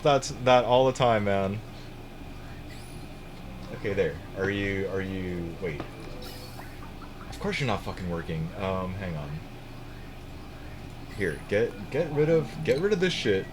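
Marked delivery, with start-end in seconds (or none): none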